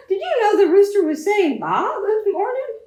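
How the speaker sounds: high, loud puzzled question; astonished farmer. Acted. Farmer asks the sheep about the rooster, amazed and loud. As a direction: ask it in disbelief.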